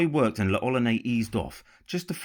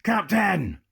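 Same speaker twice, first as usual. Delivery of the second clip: loud, higher shout of "Captain!"; pirate shouting to his captain, higher and louder; 10 semitones higher, 5 dB louder than usual. A narrator's reading. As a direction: bellow it across the deck. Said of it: "one of the pirates shouted".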